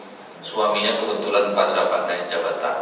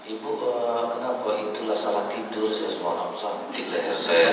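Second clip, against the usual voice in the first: softer, mild complaining murmur; soft explanation; 6 dB quieter than usual. Acted. The official's wife explaining her stiff neck, softly.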